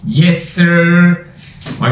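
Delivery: usual speaking voice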